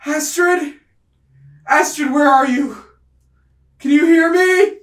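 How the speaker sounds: high, loud panicked yell; calling at the top of her lungs. Acted. Panicked Astrid searching for someone, calling at the top of her lungs. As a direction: shout out for someone missing. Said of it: "calling at the top of her lungs".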